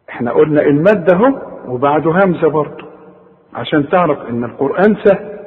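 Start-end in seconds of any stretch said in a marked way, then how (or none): none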